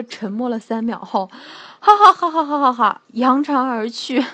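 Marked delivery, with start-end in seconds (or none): 1.8-3.0 loud, higher mock guffaw; acted hearty laugh. Brother laughs off the accusation after silence, mock guffaw. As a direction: laugh it out in a string of ha-has.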